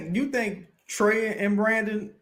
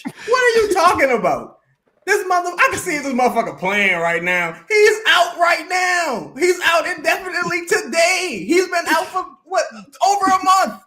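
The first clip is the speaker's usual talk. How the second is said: furious shout; 6 semitones higher than usual. Real, not acted. Host screaming at a commenter who says an injured player is fine, furious shout.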